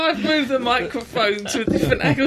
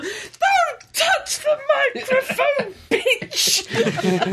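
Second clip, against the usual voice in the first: mock yell; 13 semitones higher, much slower than usual. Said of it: "phoned me up and gone".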